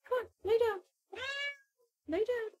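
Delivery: very high, soft pet talk; tiny high voice for a pet; gentle coaxing. Real, not acted. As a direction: coax the cat softly, high-pitched. Coaxing cat to lie down.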